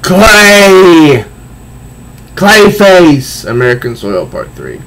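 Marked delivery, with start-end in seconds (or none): 0.0-3.4 higher, louder boastful yell; hammed-up villain yell; villain shout. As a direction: roar out his own name. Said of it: "he says".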